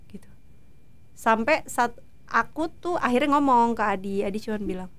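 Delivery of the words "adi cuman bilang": ordinary talk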